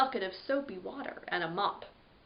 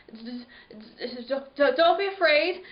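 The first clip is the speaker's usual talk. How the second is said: scared stammer; 3 semitones higher, 5 dB louder than usual. Acted. Scared narrator stammers reassurance.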